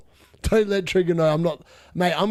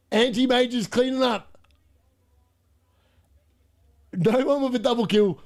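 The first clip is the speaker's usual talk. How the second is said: esports caster; 2 semitones higher than usual. Retold or imitated. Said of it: "they're casting the game".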